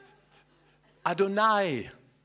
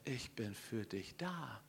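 tender; 4 semitones lower, 10 dB quieter, slower than usual. Acted. God's tender promise.

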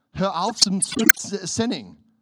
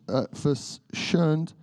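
normal talking voice

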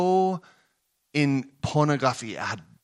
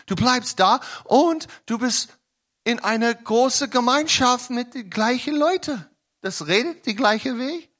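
higher, urgent sermon voice; impassioned preaching; 9 semitones higher than usual. Acted. Preacher challenges listeners about their community, urgent. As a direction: press the point on the crowd.

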